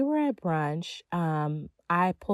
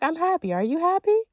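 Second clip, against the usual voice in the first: bright higher happy chirp; high cheery sing-song; 10 semitones higher, 3 dB louder than usual. Shown as a demonstration. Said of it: "I'd be like".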